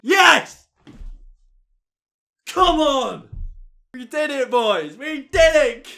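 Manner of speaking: loud, high victory yell; triumphant shout. Real, not acted. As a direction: celebrate the win at full voice. Winning first in the world.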